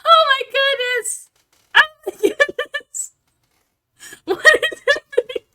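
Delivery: very high, loud squealing; overwhelmed delight. Real, not acted. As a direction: squeal through giddy laughter in disbelief. Overwhelmed by flood of subs.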